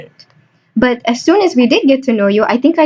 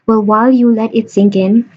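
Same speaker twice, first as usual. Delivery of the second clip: usual speaking voice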